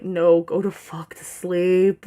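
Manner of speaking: slow, dragged-out voice of a worn-out body; body's voice. Pretend. The worn-out body tells her to sleep, slow and drawn out. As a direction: drawl it like the body is begging for sleep. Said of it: "my body's just like".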